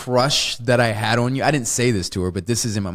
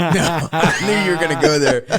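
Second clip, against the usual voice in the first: laughing, fast and amused; real laughter through the words; 5 semitones higher than usual. Real, not acted. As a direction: caught out, cracking up.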